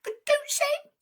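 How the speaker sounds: very high squeaky voice; tiny creature, warning; little crab, reluctant. Acted. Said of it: "And Little Crab says".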